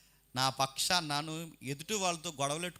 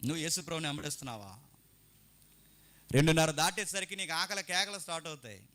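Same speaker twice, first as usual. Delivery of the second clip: ordinary talk, nothing marked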